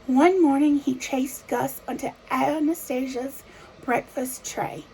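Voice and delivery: ordinary delivery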